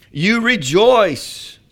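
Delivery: high, loud, very drawn-out proclamation; exultant shout. Acted. Preacher reading scripture to the congregation, exultant shout. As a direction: stretch it out and shout it joyfully.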